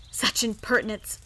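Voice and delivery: ordinary delivery, nothing marked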